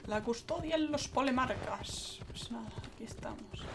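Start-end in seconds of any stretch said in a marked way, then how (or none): 2.3-3.6 whispering. Real, not acted. Quiet aside while playing.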